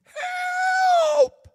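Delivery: high, loud call from far off; panicked distant yell; distant cry for help. Retold or imitated. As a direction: cry out like a lost man in the woods. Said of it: "in the distance, I heard".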